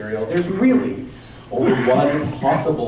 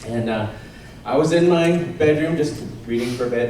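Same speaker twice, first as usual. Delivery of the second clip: ordinary talk, nothing marked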